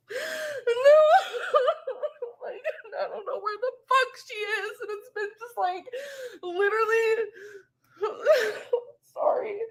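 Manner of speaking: tearful laugh cracking into crying; breaking down while trying to laugh; laughing through tears. Real, not acted. Breaks down over her missing cat.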